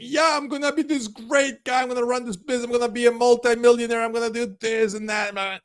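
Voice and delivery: high, far-off boastful daydream; dreamy boast. Pretend. Dreaming aloud of becoming a multimillionaire, high and boastful. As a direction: brag about big plans in a high voice. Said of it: "one day you're gonna think".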